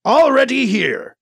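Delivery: high, loud, slow reply; Kat's voice. A narrator's reading. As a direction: announce it drawn out as Kat. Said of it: "Kat said".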